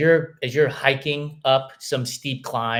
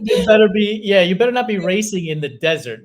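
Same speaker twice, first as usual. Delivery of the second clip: laughing; 8 semitones higher, 7 dB louder than usual. Real, not acted. Laughing mock warning.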